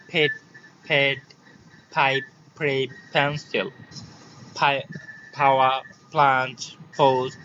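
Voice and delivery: word list, each opening P with a puff of air, as in "ফেট"; separated model words, airy p as in "ফোর্স"; aspirated P. Shown as a demonstration. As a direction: drill "পাইপ" and "ফাওয়া" one by one, p aspirated. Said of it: "ঠিক এগুলো এইভাবে উচ্চারণ করবে".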